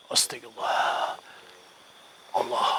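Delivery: drawn-out whispered astagfirullah; slow whispered prayer. Real, not acted. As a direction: whisper it slowly, afraid. (bisikan doa pelan)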